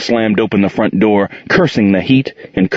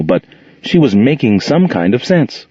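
plain reading voice

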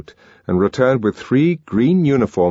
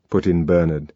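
ordinary delivery, nothing marked

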